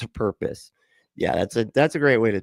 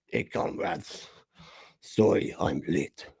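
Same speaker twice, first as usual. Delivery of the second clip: put-on Russian-ish accent; 5 semitones lower, 7 dB quieter, slower than usual. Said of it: "That's how he talks".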